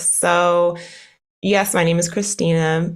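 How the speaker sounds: ordinary talk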